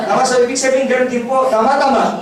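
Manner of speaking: loud, laughing through the words. Real, not acted. Speaker laughing with the audience, loud and merry. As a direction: laugh along with the crowd as you ask.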